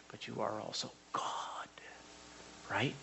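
near-whispered awe; reverent hush. Acted. Worshiper addressing the Father, hushed with awe. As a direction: drop to an awed whisper.

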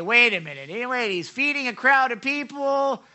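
lightly acted loud protest; objecting scholar. Preacher voices doubting scholars, mock objection. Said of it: "some scholars will say".

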